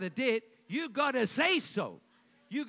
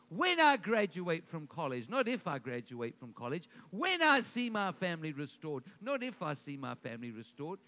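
"When" strong, "not if" lower and dismissive; when versus not if contrast; 4 semitones lower than usual. Shown as a demonstration. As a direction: contrast certainty with doubt. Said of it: "not if ... but when".

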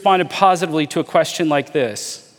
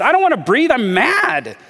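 imitating an angry self, pitched up; retold anger; 8 semitones higher than usual. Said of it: "I was so mad when he said that".

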